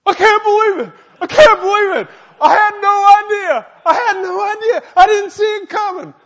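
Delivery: very high, loud stunned squeal; brother's shock. Retold or imitated. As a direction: play the brother aghast. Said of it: "He said".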